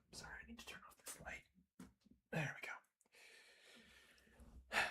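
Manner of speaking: fast unvoiced whisper; whispered aside. Real, not acted. Game master whispering an aside to the players about the light. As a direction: slip in a quick whisper.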